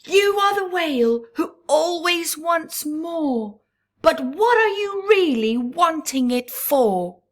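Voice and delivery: high, loud, bold questioning; loud challenge. A narrator's reading. Crab challenging the whale about his greed, loud. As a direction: confront him boldly, full voice. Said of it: "She yelled it so loud that she thought she might pop".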